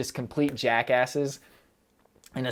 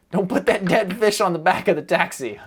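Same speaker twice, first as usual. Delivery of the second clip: louder, higher imitated shout; mock yell at tourist; 6 semitones higher, 7 dB louder than usual. Tokyo locals scolding the camera-wielding tourist, mock yell. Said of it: "people are just like".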